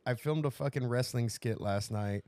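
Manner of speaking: ordinary talk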